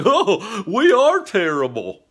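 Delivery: high, loud, slow mock-guilty crowd; mocking the cheering crowd. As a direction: ham up the audience's smug fake shame. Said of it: "people in the crowd were cheering and laughing, like".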